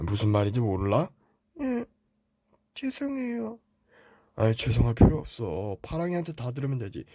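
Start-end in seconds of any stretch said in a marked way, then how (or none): 1.6-3.6 put-on high, soft kid's voice; small boy apologizing softly to dad; little brother apologizing. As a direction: say sorry like a small meek child. Younger Teletubby apologizes.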